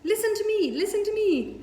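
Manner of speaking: very high, loud screech; goose screaming. A narrator's reading. Goose screaming over the hullabaloo. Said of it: "screamed the goose".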